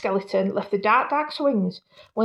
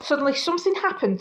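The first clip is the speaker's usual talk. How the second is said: plain reading voice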